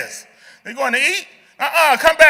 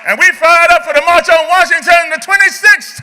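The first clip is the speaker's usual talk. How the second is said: loud, high crowd-rousing close; rally the whole room to applaud; rousing rally shout; 4 semitones higher, 5 dB louder than usual. Real, not acted.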